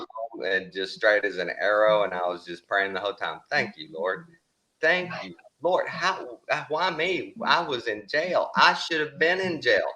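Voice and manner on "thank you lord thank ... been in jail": grateful prayer. Retold or imitated. Retells praying while driving home.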